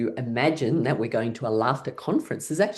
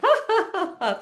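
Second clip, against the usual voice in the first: high, playful forced laugh; exercise laughter. Pretend. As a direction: laugh it out on purpose. Laughter exercise, tossing drink away.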